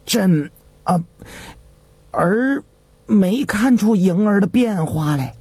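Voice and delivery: put-on young emperor stalling with pauses; hesitant mumble. A narrator's reading. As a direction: hem and haw, dodging the question. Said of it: "只支吾着说". (支支吾吾地回答)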